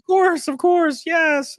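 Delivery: very high, slow sarcastic assent; hammed-up obliging falsetto; mock agreement. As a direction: agree in a squeaky put-on voice. Sarcastically agreeing politicians are exempt.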